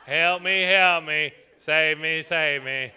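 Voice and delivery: imitated lackluster plea; mocking weak attempt. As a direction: mimic a half-hearted damsel, pitched low. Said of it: "That wasn't what we would call".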